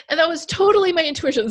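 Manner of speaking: getting choked up, real; tearful. Real, not acted. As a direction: voice rising with tears. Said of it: "Sorry, I'm gonna teary".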